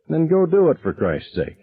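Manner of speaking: a touch of an impatient dad barking; irritated snap. Acted. Dad waving his son off to pee, impatient. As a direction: brush the kid off sharply.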